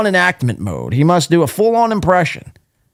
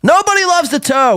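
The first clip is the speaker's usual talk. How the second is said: high mocking voice; 13 semitones higher than usual.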